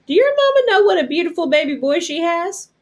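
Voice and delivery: lightly voiced mother beaming over the boy; fond Momo Bay voice. Mother figure praising the boy.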